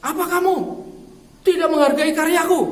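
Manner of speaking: high, loud indignant outburst; retold angry lecturer, pitched high. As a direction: snap at the student like an offended lecturer. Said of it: "dosen itu marah".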